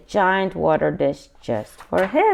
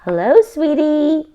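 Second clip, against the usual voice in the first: high, louder sweet grandma voice; grandma greeting; 7 semitones higher, 5 dB louder than usual. A narrator's reading.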